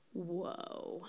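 hushed whoa. Real, not acted. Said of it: "amazed at God's turn".

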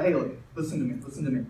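low, soft, patient coaching; quiet, calm instruction. Retold or imitated. Coach to his afraid kicker after the mistake, quiet instruction. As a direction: take the player aside and speak softly.

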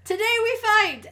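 loud, high rallying cry; rally the troops; roaring rallying speech. Retold or imitated. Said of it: "he's just like".

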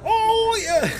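excited shout. Real, not acted. Reacting to the clip.

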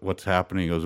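ordinary talk

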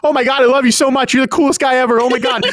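high, breathless fanboy babble; gushing Deku. Retold or imitated. Deku asks his hero for notes, gushing fanboy. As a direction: gush and stumble like a starstruck fan.

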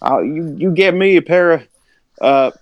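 lightly lampoon a folksy guest making demands; imitating Aaron Frederick. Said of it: "He's like".

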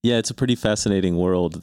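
ordinary talk

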